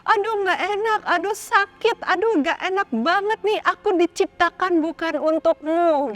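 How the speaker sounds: high, mock-pained protest; complaining shoe. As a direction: playfully cry out like an aching shoe. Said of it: "pasti sama-sama teriak".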